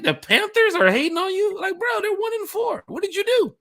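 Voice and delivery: mocking disbelief. Teasing Joseph that a one-and-four team is hating, high and mocking. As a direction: scoff at the idea anyone's hating.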